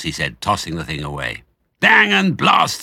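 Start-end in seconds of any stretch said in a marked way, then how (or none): 1.8-2.8 high, loud and slow, cursing the miss; grumpy farmer Boggis. Acted. Farmer cursing his missed shot, high loud slow. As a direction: spit out the curse in a high cranky farmer voice.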